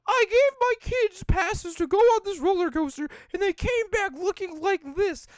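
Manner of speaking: mother voice. Retold or imitated. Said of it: "she was like".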